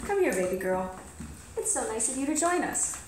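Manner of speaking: high sing-song pet talk. Real, not acted. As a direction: coo at the cat. Talking sweetly to the cat.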